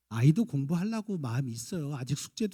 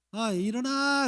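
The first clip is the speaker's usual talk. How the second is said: playful wake-up call; 10 semitones higher, 7 dB louder, much slower than usual. Retold or imitated. Father waking his son playfully.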